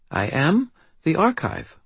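put-on high little-girl voice, gravely self-possessed; steady, assured child. A narrator's reading. Child introduces herself to the wizard, steady and assured. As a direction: announce it as an assured British child. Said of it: "her voice steady and assured".

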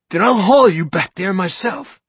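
high, loud threat; a loud, furious threat; angry threat. Acted. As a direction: lay down the threat. Narrator threatens.

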